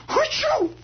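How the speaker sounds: high squeaky sneeze; doll's sneeze. Retold or imitated. Comedian imitating the doll's sneeze. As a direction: burst out a tiny doll sneeze. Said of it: "The doll sneezes".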